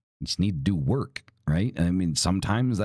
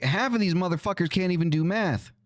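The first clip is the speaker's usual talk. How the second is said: ordinary talk, nothing marked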